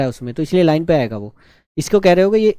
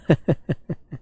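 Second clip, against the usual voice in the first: short, quiet run of laughter; soft chuckle; 6 dB quieter than usual. Real, not acted. Teacher laughs after teasing students about shaming him, soft chuckle. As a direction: chuckle softly to yourself.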